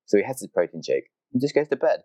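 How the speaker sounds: ordinary talk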